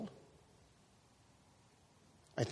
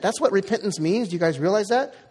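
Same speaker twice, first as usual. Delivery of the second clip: ordinary talk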